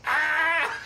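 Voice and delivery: high, loud yelp of pain; mock scream. Friend retelling Frank on the slide, yelping. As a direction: yelp like sliding down bare plastic. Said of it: "you just hear Frank going".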